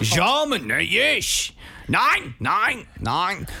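high, emphatic fake German; mock German accent. Told to be German in an accent game, high hammed-up German accent. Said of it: "You are German".

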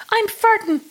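weird voice. Retold or imitated. Honked at in the car, a silly high squeak. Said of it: "in a weird".

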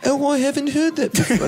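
put-on high, hammy British accent; a child's British accent, badly done. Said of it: "as Frank would say in a charming British accent".

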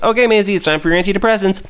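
cheerfully dosing the cat; chirpy owner voice. Retold or imitated. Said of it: "I'm like".

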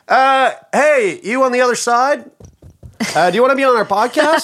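high, loud goofy voice; squeaky put-on stranger voice; glory hole voice. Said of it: "That was a horrible impression".

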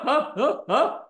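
ordinary delivery

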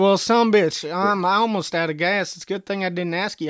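hammed-up country cursing; mock exasperated outburst. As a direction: blurt it out like your luck just ran dry. Caller mock frustrated outburst.

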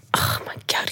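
breathless unvoiced whisper of alarm; whispered oh my God. Retelling the drugged friend, a whispered gasp. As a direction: hush it like a panicked aside.

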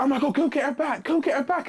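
high, gushing rom-com fan; excited cheering. Retold or imitated. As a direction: squeal it like rooting for lovers.

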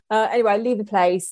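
usual speaking voice

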